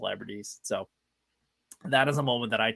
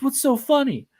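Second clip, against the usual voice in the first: innocent question; 13 semitones higher, 3 dB louder than usual. Retold or imitated. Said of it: "He goes".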